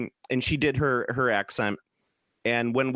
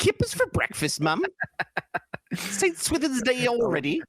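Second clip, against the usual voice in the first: very high, hammed-up British caricature; mock British accent; 13 semitones higher than usual. Trading silly quotes with his co-host, mock British accent. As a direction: squeal it like a cartoon Brit.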